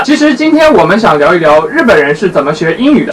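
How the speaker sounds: normal talking voice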